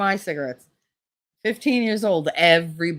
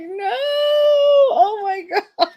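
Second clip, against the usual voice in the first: upset wail; 7 dB louder than usual. Retold or imitated. Child upset to learn dinner was rabbit, theatrical wail. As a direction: cry out in hammed-up horror. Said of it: "I was so upset".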